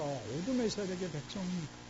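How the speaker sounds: ordinary delivery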